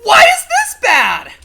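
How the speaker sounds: very high, loud, drawn-out wail; slow high scream, close to crying; anguished shout. Real, not acted. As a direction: wail in despair at the game. Wailing at the game's bad luck.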